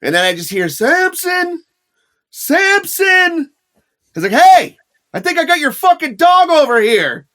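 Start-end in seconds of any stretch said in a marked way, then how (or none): 0.7-3.5 high, far-off shout of "Samson"; calling out a name. Retold or imitated. Owner calling for lost dog. 4.4-7.3 high, loud call across the yard. Retold or imitated. Host calling to the dog's owner, raised shout.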